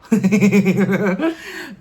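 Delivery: loud, high burst of giggling; real laugh. Real, not acted. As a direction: laugh out loud at your own impression. Laughs at own impression.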